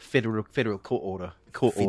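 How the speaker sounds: Kiwi accent, r's dropped from court order; New Zealand accent. Shown as a demonstration. Host demonstrates the trick phrase, New Zealand accent. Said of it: "you could totally be a Kiwi".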